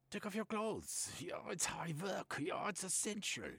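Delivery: put-on Reich in a German accent, eagerly expounding.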